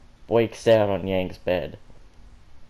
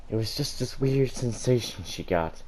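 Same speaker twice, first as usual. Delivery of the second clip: ordinary delivery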